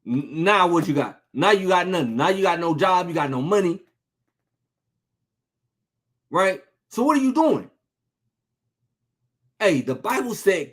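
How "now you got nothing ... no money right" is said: normal talking voice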